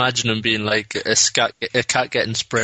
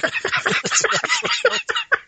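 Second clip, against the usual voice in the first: high squealing laugh. Real, not acted. Laughing at own impression.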